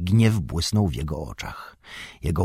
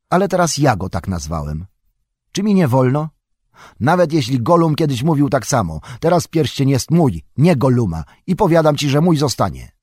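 loud, higher, defiant claim; defiant anger; 7 semitones higher, 6 dB louder than usual. A narrator's reading. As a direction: refuse to give it up. Bilbo's defiant outburst.

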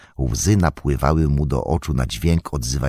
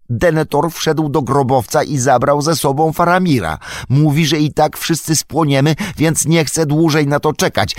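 much higher, louder, rushing anxious hobbit voice; breathless Pippin; 10 semitones higher, 5 dB louder than usual. Acted. Pippin reciting Denethor's plan to Gandalf, rushed and high. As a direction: pour it all out in one breath.

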